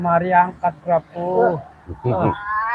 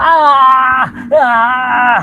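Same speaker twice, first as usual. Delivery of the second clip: loud, high groaning cry; loud pained groan; 5 semitones higher, 7 dB louder than usual. Acted. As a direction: howl out in pain. Possessed man cries out.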